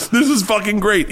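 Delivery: high delighted exclamation; drunken delight. Retold or imitated. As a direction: cheer it like a happy drunk. Retold drunk joy.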